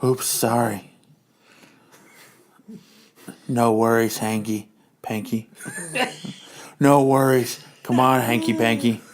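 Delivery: villainess voice. Acted. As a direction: purr it like a scheming villain lady. Madam Hydra flirting.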